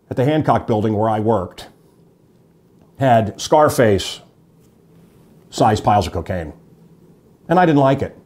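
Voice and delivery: normal talking voice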